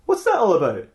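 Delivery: normal talking voice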